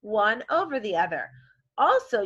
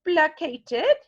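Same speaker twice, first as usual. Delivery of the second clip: playful model; 5 semitones higher, 5 dB louder than usual. Shown as a demonstration. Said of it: "you say it back however I say it".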